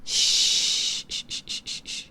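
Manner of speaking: gentle repeated shushing to calm them; soothing shushing. Acted. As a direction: witch calming her panicking familiar with a whispered hush. Soothing the panicking familiar.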